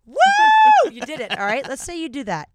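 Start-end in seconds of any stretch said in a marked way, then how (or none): 0.1-0.9 loud, high-pitched "woo"; celebratory whoop. Pretend. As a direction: let out a big victory whoop. Cheering a cleared to-do list.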